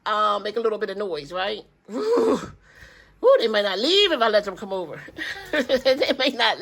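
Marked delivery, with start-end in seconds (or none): 1.9-2.4 woo. Real, not acted. Exclaiming about grandkids.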